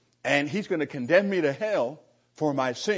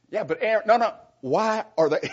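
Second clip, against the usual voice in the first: flustered caller; 7 semitones higher, 5 dB louder than usual. Retold or imitated. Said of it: "the guy tried to answer".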